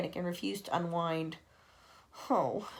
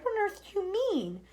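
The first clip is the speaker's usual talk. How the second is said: high startled question; shocked disbelief; alarmed cry; 12 semitones higher than usual. Acted. The creatures alarmed at the ladybird's news. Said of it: "they cried".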